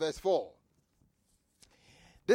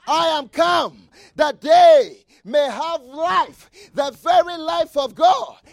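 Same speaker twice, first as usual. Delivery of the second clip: slow, booming proclamation, louder; shouted scripture; 6 semitones higher, 11 dB louder, slower than usual. Acted. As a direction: proclaim it like a triumphant cry. Shouted Jesus quote in sermon.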